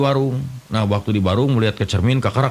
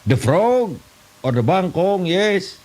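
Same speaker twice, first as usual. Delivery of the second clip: much higher, slow mock English; broken English; 8 semitones higher, much slower than usual. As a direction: ham up clumsy English. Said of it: "Bahasa Inggris tapi kepakojot". (bahasa Inggris ngawur, nada tinggi dibuat-buat)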